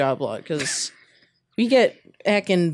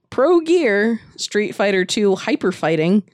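ordinary delivery

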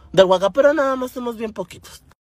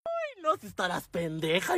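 soft mock-exasperated mother voice; 12 dB quieter than usual. Mother scolding over the wrong bus, exasperated. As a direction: sigh it out as a fed-up mom.

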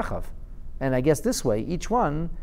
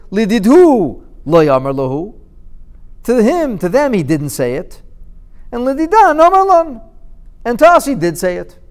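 smug prophets; 8 semitones higher, 8 dB louder than usual. Acted. Prophets' reply, emphatic.

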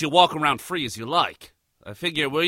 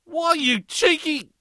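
higher, slower, outraged start; indignant splutter; 13 semitones higher, slower than usual. Acted. Aussie realizes his captive fooled him, indignant splutter. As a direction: splutter at the cheek of it.

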